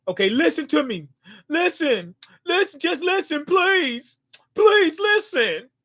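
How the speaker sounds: mock desperate begging; rising plea. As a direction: beg him to just listen. Begging character to listen.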